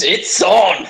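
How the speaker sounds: high, punchy war cry; battle-ready declaration. Acted. Player voices her character spotting the super soaker, battle-ready declaration. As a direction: call it out loud, raring to fight. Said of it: "looks at it and goes".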